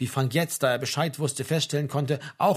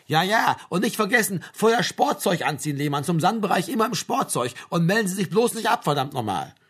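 impatient army sergeant; 5 semitones higher than usual. Acted. Sergeant sends soldier off in sports kit, impatient. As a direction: fire reminders at a departing soldier.

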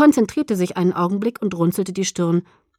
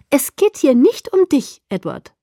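emphatic retort; 7 semitones higher, 6 dB louder than usual. A narrator's reading. Alice snaps back at Edward in story.

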